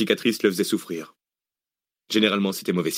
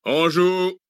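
high, drawn-out military shout; shouted firing command; 10 semitones higher, 3 dB louder than usual. A narrator's reading. Mercenary chief repeats the order to his firing squad, shouting. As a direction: call the squad to aim. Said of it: "répéta Zeytin".

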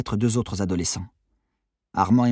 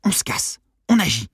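urging, with "On agit" higher and louder; insistent repeat; 8 semitones higher, 4 dB louder than usual. Acted. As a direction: drive the getaway home to a doubting friend. Repeats proposal after "Quoi?. (répétition insistante, plus énergique)

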